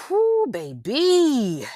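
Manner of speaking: slow, high, loud whoo of disbelief; stunned exclamation. Real, not acted. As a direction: let it out like you can't believe it.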